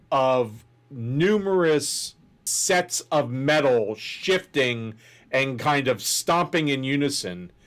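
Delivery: normal talking voice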